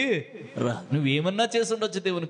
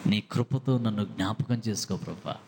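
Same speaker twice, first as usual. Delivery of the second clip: humble prayer; 7 semitones lower, slower than usual. Retold or imitated. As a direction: draw each word out humbly. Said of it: "What a humbleness". (చాలా నెమ్మదిగా తగ్గింపుతో ప్రార్థన)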